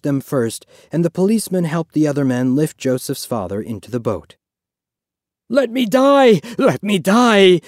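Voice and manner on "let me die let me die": loud, high wail, slowing on the repeat; begging the sea to take him; anguished cry. A narrator's reading. Father in the boat begging to be left to drown, wailing. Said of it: "Joseph's father cried".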